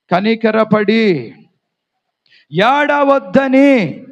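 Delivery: slow drawn-out emphasis. Shown as a demonstration. Reading the key words aloud.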